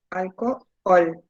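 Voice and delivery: slow, low "Alcohol", pausing before the final OL; "Alcohol" in two slow pieces, final syllable set apart; stress on last syllable. Shown as a demonstration. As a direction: split "Alcohol" as alco... OL. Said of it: "En la última lleva la sílaba tónica".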